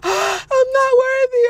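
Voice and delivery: gasping, very high, loud and drawn out; mock unworthiness. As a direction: overact being overwhelmed and undeserving. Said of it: "don't act all".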